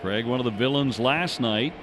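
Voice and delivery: ordinary delivery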